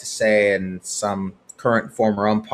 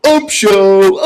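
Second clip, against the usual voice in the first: mock heckle; 10 semitones higher, 10 dB louder than usual. Repeated heckle.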